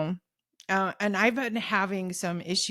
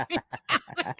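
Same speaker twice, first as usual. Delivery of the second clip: ordinary delivery, nothing marked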